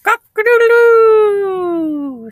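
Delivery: very high, loud, long crow; piercing falsetto cock-a-doodle-doo; crowing rooster. Acted. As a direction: crow like a rooster with a held final note. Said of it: "said the rooster".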